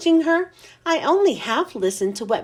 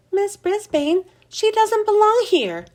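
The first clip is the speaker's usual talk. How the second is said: high squeaky blurt, pitched way up; squeaked out loud; 8 semitones higher than usual. A narrator's reading. Hamster squeaks out loud to his teacher, high and alarmed. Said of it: "I squeaked out loud".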